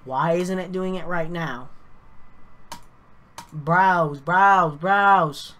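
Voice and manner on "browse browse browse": loud, punched repetition; impatient. Real, not acted. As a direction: hammer each word out loudly in exasperation. Impatient repeated clicking through failing software.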